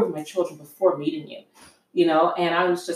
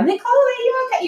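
high, fast, louder mock-mom voice; hammed-up anxious mom on the phone; worried mother; 5 dB louder, faster than usual. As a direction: imitate a fretting mother checking in. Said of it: "she would have been calling me".

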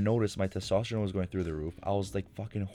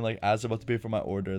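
normal talking voice

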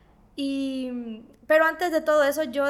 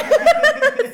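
loud, high-pitched laugh; high burst of laughter; 14 semitones higher, 9 dB louder than usual. Real, not acted. Laughing with the host about the new house, loud laughter. Delighted at retelling her exclamation.

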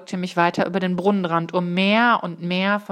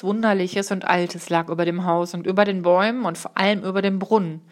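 ordinary delivery

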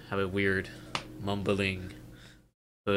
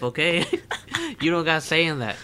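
normal talking voice

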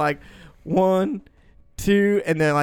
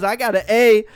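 mock drunk girl; 5 semitones higher, 5 dB louder than usual. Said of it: "She goes".

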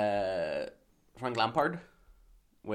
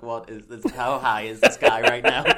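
laughing through the words; incredulous, breaking into laughs; laughing disbelief; 13 dB louder than usual. Retold or imitated. Retold own reaction, laughing.

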